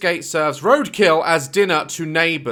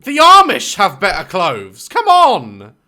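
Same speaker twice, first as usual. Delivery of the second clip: louder, exasperated complaint; loud outrage; 4 semitones higher, 11 dB louder, slower than usual. Real, not acted. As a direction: throw up your hands and protest. Outraged at the dress.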